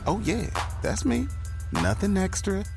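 usual speaking voice

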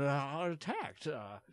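normal talking voice

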